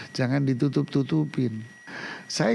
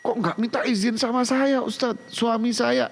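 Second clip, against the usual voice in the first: imitating an upset wife, pitched up; mock complaining wife; 8 semitones higher than usual. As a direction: mimic the wife's indignant complaint. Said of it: "udah keluar bahasa ibu-ibu".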